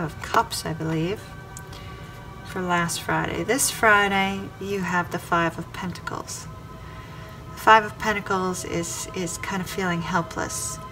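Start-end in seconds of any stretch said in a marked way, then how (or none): none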